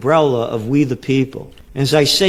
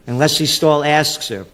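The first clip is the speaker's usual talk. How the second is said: usual speaking voice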